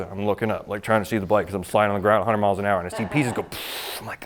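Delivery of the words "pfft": unvoiced pfft of flying debris; quick airy "pfft" sound effect; imitated burst. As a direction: puff out a short noise like parts scattering. Imitating bike pieces flying off.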